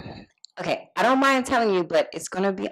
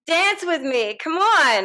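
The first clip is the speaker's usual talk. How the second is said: high, bubbly party invitation; excited; 9 semitones higher, slower than usual. Acted. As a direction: pull him onto the floor, giddy. Drunk girl pulling him to dance.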